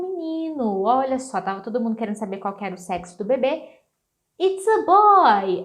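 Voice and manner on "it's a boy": excited announcement. Pretend.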